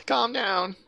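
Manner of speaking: high, flustered stammer; stammering assistant. A narrator's reading. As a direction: trip over the words, pleading in a high voice. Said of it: "the assistant sort of stammers".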